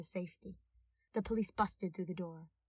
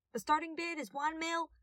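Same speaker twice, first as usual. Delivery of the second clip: auctioneer; 8 semitones higher, 4 dB louder than usual. Acted. Auctioneer announcing bid.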